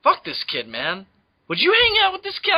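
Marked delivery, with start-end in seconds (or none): 1.5-2.5 high, loud indignant question; incredulous outrage. Acted. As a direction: demand an answer in mock disbelief. Mock outraged rhetorical question.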